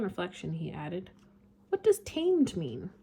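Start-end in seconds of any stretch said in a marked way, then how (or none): 1.7-2.9 little prince voice. Acted. Prince voiced high, slow.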